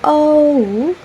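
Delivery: "o" drawn out high, gliding oh to oo; demonstrated diphthong. Shown as a demonstration. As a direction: stretch "o" into a long oh-oo. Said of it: "another diphthong is".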